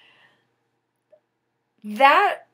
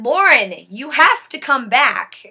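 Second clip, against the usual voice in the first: 1 louder mother's plea; 2 semitones higher, 8 dB louder than usual. Retold or imitated. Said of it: "She said".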